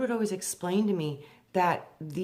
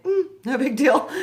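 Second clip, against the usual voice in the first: casual shrug; 8 semitones higher, 9 dB louder than usual. Retold or imitated. Imitating calm Lord's shrug.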